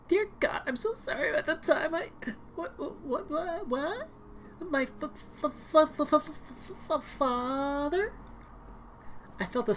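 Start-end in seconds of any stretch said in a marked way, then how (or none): 0.1-8.1 much higher, stuttering in shock; stammering girly voice. Acted. Scared daughter stuttering at her rescuer, high and shaken. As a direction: stammer out father in disbelief.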